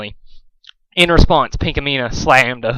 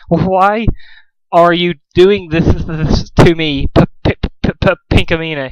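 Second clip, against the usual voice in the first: terrified stammer; 4 semitones higher than usual. A narrator's reading. Dusk stammering in pain.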